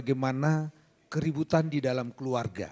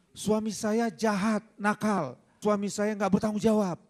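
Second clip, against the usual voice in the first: higher-pitched aggrieved wife, lightly imitated; mock tearful complaint; 6 semitones higher than usual. Wife complaining to lawyer about husband, mock upset. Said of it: "pada saat dia nangis".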